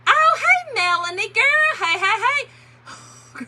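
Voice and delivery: high, loud, gleeful hello; excited greeting. Real, not acted. Vlogger spotting Melanie among the viewers, real excited greeting. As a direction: squeal hello to a friend.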